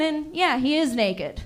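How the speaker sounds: ordinary delivery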